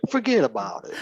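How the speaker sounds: high-pitched, hammed-up New York accent; New Yorker accent. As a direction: play up your best New Yorker accent on request. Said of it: "in your best New Yorker accent".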